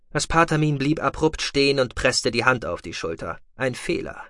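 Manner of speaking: ordinary delivery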